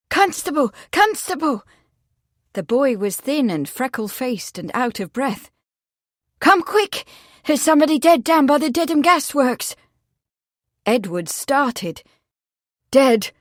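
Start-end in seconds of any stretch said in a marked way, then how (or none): 0.1-1.6 put-on high-pitched boy's urgent call. A narrator's reading. A boy summons the constable, calling urgently. 6.4-9.7 put-on high, loud, excited boy; urgent. A narrator's reading. A boy brings the constable news, urgently. Breathless boy reports a body. 12.9-13.3 loud, higher shocked exclamation; exclaimed. A narrator's reading. Edward fears the body is Betsy, exclaiming. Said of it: "he exclaimed".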